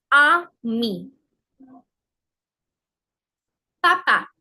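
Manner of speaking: each word said apart, open ah for every A; slow French model words for learners to repeat; careful word-by-word French with a clear ah; French pronunciation demo. Shown as a demonstration. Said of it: "Try to pronounce the words after me".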